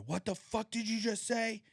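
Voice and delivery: shouting in a rage; furious yell at son. Retold or imitated. Father yelling at his son in front of guests, furious shout. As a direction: yell it, losing your mind. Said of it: "lost my fucking mind".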